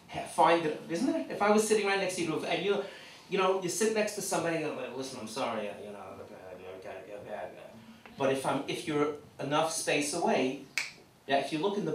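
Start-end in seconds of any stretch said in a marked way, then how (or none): 4.8-7.5 low, soft mumbled excuse; muttered apology. Acted. To someone sat too close, muttered apology. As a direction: mumble it low and trailing away.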